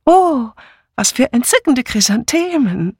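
high, loud gushing oh. Acted. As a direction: gush over the flowers. Guests gush over the flowers.

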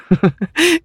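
hohes Kichern. Acted. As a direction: kichere aufgeregt mit hoher Stimme. Figur kichert über die eigene Angst. (helles, aufgeregtes Kichern)

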